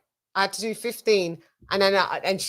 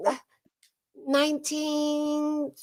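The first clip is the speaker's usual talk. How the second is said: put-on hesitant child; 7 semitones higher, 6 dB quieter, slower than usual. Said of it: "And she said".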